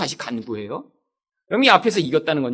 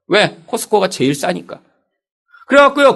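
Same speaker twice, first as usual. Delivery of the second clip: ordinary delivery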